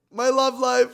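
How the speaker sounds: high, loud squealed announcement; dramatic announcement. Real, not acted. Telling followers the next topic, dramatic squeal.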